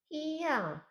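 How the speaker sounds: high, soft put-on female cry. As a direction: pitch it way up, quietly, like a woman. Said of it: "That was my female voice".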